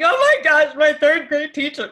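high, loud, half-laughing breakdown; retelling how she broke down in front of the officer, high and loud; losing it again. Retold or imitated. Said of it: "Like, I lose it again".

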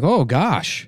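high, wholesome exclamation; mock old-timey gosh-golly voice; old-timey soda jerk. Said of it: "old-timey, like, a soda jerk guy".

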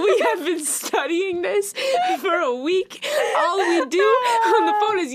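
high, loud excited gushing. Real, not acted. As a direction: gush with laughing excitement. Excited gushing about study.

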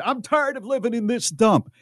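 loud, high mock complaint; imagined angry wife. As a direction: act out her fed-up outburst. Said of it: "let's say she said".

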